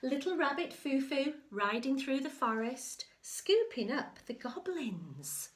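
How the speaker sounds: sing-song picture-book telling; storybook narration. Acted. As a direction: build the excitement for a child.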